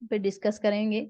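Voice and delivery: ordinary delivery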